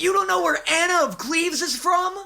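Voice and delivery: a snooty voice mocking his ignorance; mock scolding.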